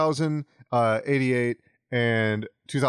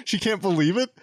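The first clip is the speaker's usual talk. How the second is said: ordinary talk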